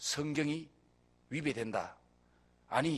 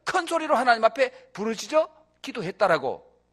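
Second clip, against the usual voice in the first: loud, higher preaching build; preaching at full force; 5 semitones higher, 5 dB louder than usual. Acted. Pastor driving home that God likes loud prayer, loud. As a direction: press each phrase out loud to the crowd.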